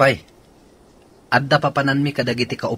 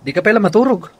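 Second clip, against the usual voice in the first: sharp, higher, louder snap; annoyed; 10 semitones higher, 7 dB louder than usual. Acted. Wife snapping at her husband at night, annoyed. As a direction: scold him for not sleeping.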